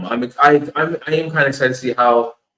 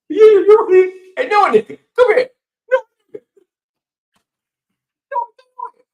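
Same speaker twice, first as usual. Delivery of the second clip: very high calling voice; hammed-up high call; mock calling out; 7 dB louder than usual. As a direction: call the name high like across a yard. Calling out the name mockingly.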